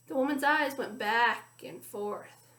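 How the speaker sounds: plain reading voice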